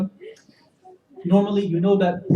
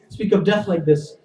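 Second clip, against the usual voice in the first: usual speaking voice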